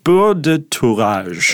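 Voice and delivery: "beurre de tourage" said slowly the French way; slow, careful French on "beurre de tourage"; French pronunciation demo. Shown as a demonstration. Helping co-host say the term, slow French "beurre de tourage". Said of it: "how do you say this?".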